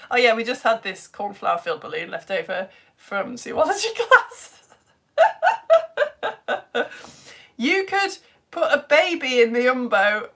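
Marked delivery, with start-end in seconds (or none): 5.2-7.1 very high, louder peals of laughter; real squealing laugh; helpless laughter. Real, not acted. Cracking up at zoology class remark.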